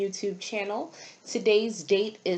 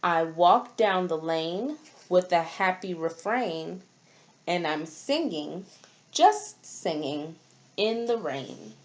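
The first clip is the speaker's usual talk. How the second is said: put-on reading to children. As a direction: read each line like a picture book page to a class. Reads storybook aloud to children.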